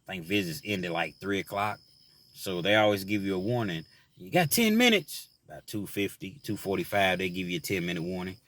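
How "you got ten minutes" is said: lightly mimicked guard calling time; a hint of a prison guard's call; quoted guard.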